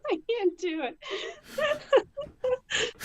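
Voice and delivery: high, giggling give-up; laughing. Real, not acted. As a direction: crack up mid-attempt. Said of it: "breaks into laughter".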